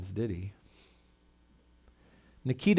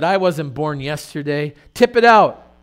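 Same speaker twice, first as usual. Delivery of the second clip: barked order; 8 dB louder than usual. Retold or imitated. Guard ordering the worker to dump the sack, loud. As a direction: snap the order at him.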